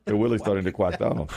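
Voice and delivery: R as W in WILLIE'S and QUACK; imitated Elmer Fudd speech. Co-host repeating the clerk's line, imitated speech impediment. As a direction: say "really" as WILLIE'S, "crack" as QUACK. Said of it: "That's Elmer Fudd".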